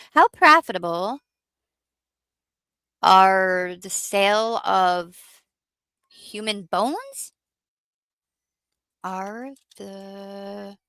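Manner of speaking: very slow, spelled out as she types; dictating slowly. Real, not acted. Reading out her search query, very slow. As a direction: drag each word while typing.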